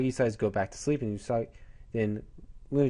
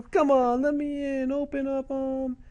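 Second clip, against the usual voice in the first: put-on very high, drawn-out girlish begging; hammed-up pleading; 13 semitones higher than usual. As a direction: beg Mom to open the door, theatrically. Locked-out girl begs her mother.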